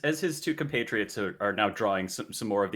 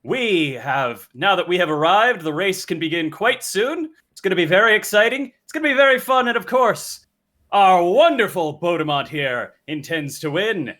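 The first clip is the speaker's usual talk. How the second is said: loud, higher herald proclamation; royal herald; 6 semitones higher, 7 dB louder than usual. Acted. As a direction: announce it to the crowd, rising. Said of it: "in his best herald voice".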